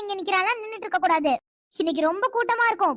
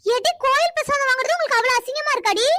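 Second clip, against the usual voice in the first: scolding rebuke; 5 semitones higher than usual. Acted. Aunt scolds girl over shyness.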